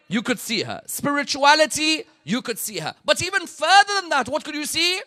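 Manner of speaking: high, loud, driving sermon delivery; preaching at full force. Acted. Preacher praising Fidda's bravery to the congregation, at full force. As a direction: lift the congregation with rising fervour.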